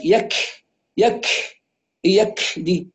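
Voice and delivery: يك with a long hissing gush of air after k; exaggerated hams. Teacher mimics those who overdo it, leaking kaf. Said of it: "وهناك من يبالغ في الهمس".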